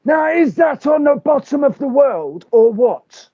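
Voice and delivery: squeaky high Terry on the phone; Terry from London. Acted. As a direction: play Terry big and excitable. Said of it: "we could do it with Terry".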